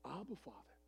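very soft reverent call; praying in the Spirit. Retold or imitated. Pastor praying inwardly to his Father, hushed. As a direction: breathe a short private prayer. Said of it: "In the spirit, I said".

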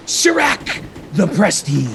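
shouted magic words. Acted. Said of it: "and just saying".

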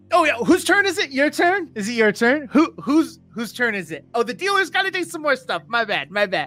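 cocky gambler bouncing with excitement; obnoxious excitement. Acted. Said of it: "super obnoxious".

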